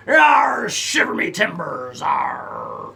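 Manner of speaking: growled pirate arr, slow middle. Pretend. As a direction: snarl it like a pirate. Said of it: "Shiver me timbers is a great one".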